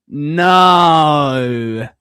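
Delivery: one long, stretched "No"; drawn-out no. Shown as a demonstration. Streamer answering complaints about the accent, drawn-out no. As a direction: hold the vowel of "No" right out. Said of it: "the way I say no".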